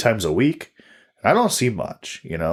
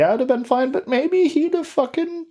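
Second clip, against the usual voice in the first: put-on old woman voice. Imagining being an old woman.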